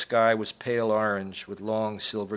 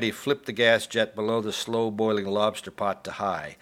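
plain reading voice

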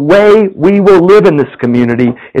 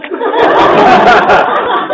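high airy laugh. Real, not acted. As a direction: chuckle at the sweet turn. Laughs at story.

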